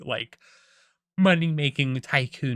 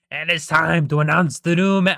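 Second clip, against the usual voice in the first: loud, higher, attempted Irish accent; Mister Krabs; 4 semitones higher, 7 dB louder than usual. Crab boss announcing the new manager, loud mock Irish accent. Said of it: "making him, like, Irish".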